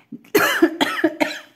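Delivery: sudden fit of harsh coughing; choking cough. Real, not acted. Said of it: "Pardon, je m'étouffe".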